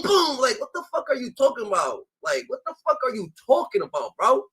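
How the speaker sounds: normal talking voice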